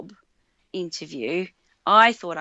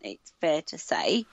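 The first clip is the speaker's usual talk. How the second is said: ordinary talk, nothing marked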